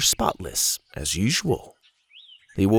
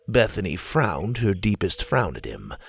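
plain reading voice

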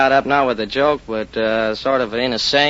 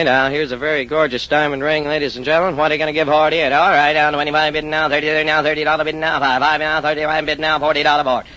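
higher-pitched auctioneer patter, bids rattled off on one note; auctioneer's pitch; 3 semitones higher than usual. Shown as a demonstration. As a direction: open grandly, then roll into a steady rapid-fire auction chant. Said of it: "curious to hear you give your pitch".

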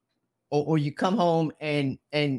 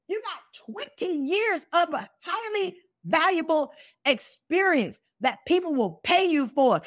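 fiery preaching; 11 semitones higher, slower than usual. Acted. Host preaching at listeners who wait to be saved, full force.

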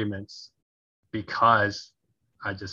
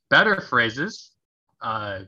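ordinary talk, nothing marked

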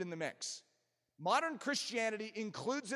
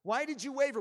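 raised, forceful repeat of the question; insistent preached question; 6 semitones higher, 4 dB louder than usual. Acted. Preacher voices Jesus challenging Peter, insistent and forceful. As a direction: press the question hard.

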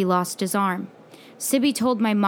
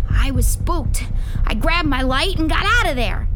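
loud, raised confession, climbing higher; spooked, excited account; 6 semitones higher, 4 dB louder than usual. Acted. Boy tells friend how he fled the cave, spooked. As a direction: tell your escape with the scare still in it.

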